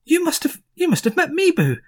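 high, eager dwarf voice; dwarf overjoyed for news of his wife; excited dwarf. Acted. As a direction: gush about the long-gone wife.

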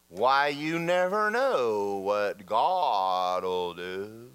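slow, drawn-out sing-song platitude; mock piety. People misquoting scripture to each other, mock pious drawl. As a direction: stretch it out like a sanctimonious churchgoer. Mocking half-quoted scripture.

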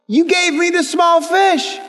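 high, slow protest; aggrieved complaint. Acted. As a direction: spell out the grievance, pitched high and drawn out. Acted complaint of short-changed friend.

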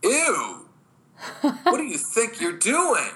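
high, disgusted mock outrage; put-on voice of a woman appalled; offended other woman. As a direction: pitch it way up, affronted. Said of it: "The other woman looks and says".

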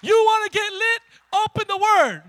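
high, very loud hype-up shout; rousing preacher's rallying cry; full-force preaching. Real, not acted. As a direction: fire up the congregation at full volume.

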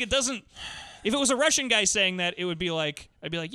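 ordinary talk, nothing marked

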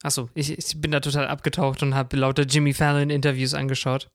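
normal talking voice

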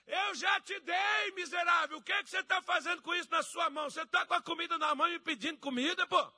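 high, loud mock-furious shouting; exasperated scolding. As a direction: berate someone who begs with food in hand. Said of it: "Ele diz assim".

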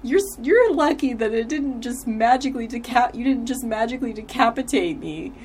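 high-pitched, put-on character voice; Andrew impression. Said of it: "and then he's like".

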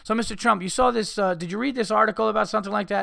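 high, loud mocking reporter voice, quick; mock interviewer. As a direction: lampoon the eager interviewer. Mocking interviewer asking Trump.

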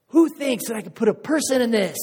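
loud, high call out to a crowd; showman's call. Acted. Tightrope walker challenging his crowd, loud showman's shout. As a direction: pitch it up like a showman working the crowd.

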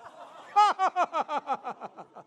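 long staccato laugh, high and falling away; demonstrative laugh. Shown as a demonstration. Preacher showing how to meet lies, long mocking laugh. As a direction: keep a forced mocking laugh rolling. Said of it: "when the lies come, what do you do".

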